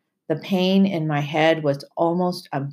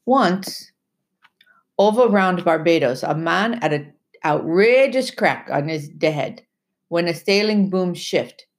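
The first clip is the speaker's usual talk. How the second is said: Timothy telling a tale. Acted. Timothy's voice in the story.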